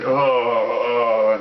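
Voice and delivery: long, loud "oh" of agony; mock pained groan. Imitating Superman felled by a pebble of kryptonite, mock groan. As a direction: groan out a drawn-out, hammed-up collapse. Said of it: "He's like".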